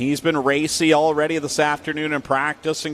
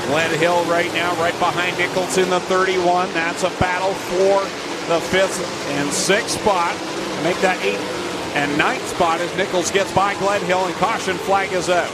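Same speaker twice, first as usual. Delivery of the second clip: motorsport commentator; 4 semitones higher than usual. Real, not acted. Live race call.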